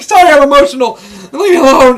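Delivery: high, loud, hammed-up crying wail; mock tearful protest. Crying fan snapping back at people in the theater, mock-tearful. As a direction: whine it through pretend tears. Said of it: "I, I started crying".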